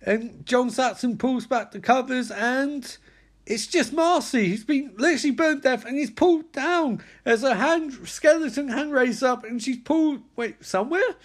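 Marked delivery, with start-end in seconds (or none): none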